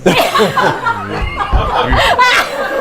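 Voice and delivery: audience bursts out laughing; crowd laughter. Real, not acted.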